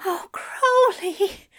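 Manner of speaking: high, drawn-out cry of pleasure. A narrator's reading. Aziraphale to lover in embrace, crying out. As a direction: cry out the name, high and slow. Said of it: "Aziraphale cries out".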